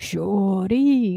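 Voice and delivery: drawn-out mock catcall; lingering street holler, imitated; Atlanta catcall. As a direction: imitate guys calling out, vowels stretched long. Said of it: "They're like".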